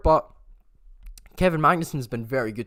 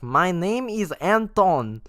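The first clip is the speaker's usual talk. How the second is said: mock Russian accent; 7 semitones higher, 6 dB louder, slower than usual. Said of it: "this TikTok my girlfriend posted where it's like".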